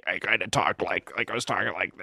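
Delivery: low, quiet guttural voice; put-on deep, guttural voice; guttural fake voice. Shown as a demonstration. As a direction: drop it down into the throat, softly. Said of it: "very guttural voice".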